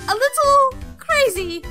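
high, incredulous repeat; indignant echo. Acted. Researcher echoes guardian's understatement, indignant echo. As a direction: throw the words back in disbelief.

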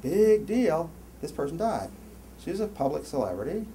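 ordinary talk, nothing marked

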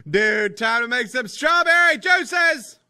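loud, drawn-out hype yell; big stretched-out cheer; excited shout. Real, not acted. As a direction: holler it slowly with glee. Cheering about strawberry juice.

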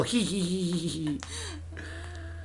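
high hee-hee giggle; giggling cackle. Acted. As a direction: cackle like a little devil. Joking about the devil laughing.

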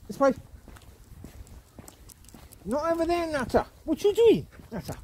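steer him back with your voice; calling the dog. Real, not acted. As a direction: owner calling his dog off the wrong path. Calling dog away from path.

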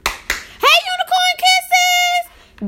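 very high, drawn-out squeal; excited high-pitched greeting. Real, not acted. Streamer greeting a viewer, excited high squeal. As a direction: squeal the viewer's name slowly, overexcited. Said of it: "my voice get high-pitched".

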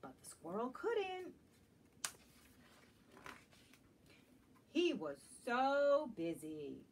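storytime refrain. A narrator's reading. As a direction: stretch it out for the kids. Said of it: "refrain read for children".

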